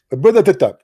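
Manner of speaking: high, loud mock preacher voice, put-on accent; hammed-up mock preacher in a put-on accent; mock Urdu preacher. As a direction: parody an Urdu speaker preaching. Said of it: "this is Urdu supposedly".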